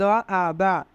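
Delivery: ordinary delivery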